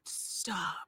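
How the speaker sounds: soft, stunned acted reaction; hushed disbelief. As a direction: say it under the breath in disbelief. Reenacts disbelief at being booked.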